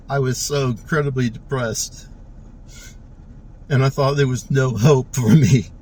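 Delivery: fighting tears while admitting past despair; choked up. Real, not acted. Speaker telling viewers his story, choked up and tearful. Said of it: "I'm getting emotional".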